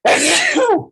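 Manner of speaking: one sharp, loud real cough. Real, not acted. Sniffly lecturer coughing before class starts, loud cough. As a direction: hack it out hard.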